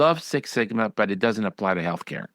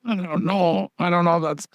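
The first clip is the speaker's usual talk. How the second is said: mimicking fretful partners, pitched higher; worried partners; 6 semitones higher than usual. Consultant mimics practice partners balking at a change, higher fretful voice. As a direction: play the hesitant partner wringing his hands. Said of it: "you get the partners twisting".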